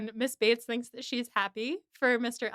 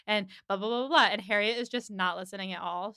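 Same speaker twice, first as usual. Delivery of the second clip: normal talking voice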